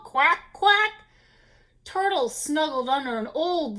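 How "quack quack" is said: high, loud duck quacks; quacking ducks. A narrator's reading. Ducks call out in the wind, quacking. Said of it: "they called".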